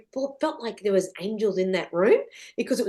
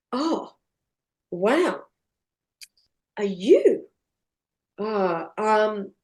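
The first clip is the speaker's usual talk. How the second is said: halting, stunned husband with long pauses; gobsmacked; much slower than usual. Retold or imitated. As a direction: grope for words in mild shock. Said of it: "he's gobsmacked".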